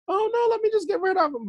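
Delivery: high-pitched mimicry of worried women; lightly voiced women. Voicing the women's worried response.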